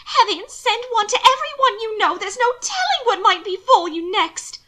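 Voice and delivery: high, loud, agitated urging; insistent. A narrator's reading. Sister fears what may befall her brother, high and insistent. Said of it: "Mina insisted".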